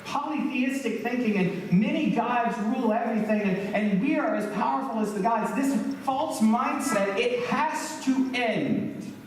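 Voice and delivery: high-pitched, insistent pronouncement that slows toward the end; God's emphatic decree. Acted. Preacher voicing God against a culture of enslavement, emphatic decree. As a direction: lay down God's verdict, pitch held high. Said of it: "God is saying".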